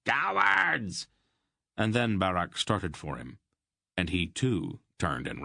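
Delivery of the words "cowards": higher furious shout. A narrator's reading. Brill screams after his running men, higher furious shout. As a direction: yell it again, much higher and strained. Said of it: "he shouted at his hirelings".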